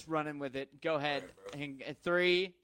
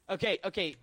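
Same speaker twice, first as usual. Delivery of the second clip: normal talking voice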